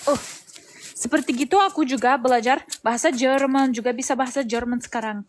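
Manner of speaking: Russian accent in Indonesian; learner's Russian-accented Indonesian; foreigner speaking indonesian. Real, not acted.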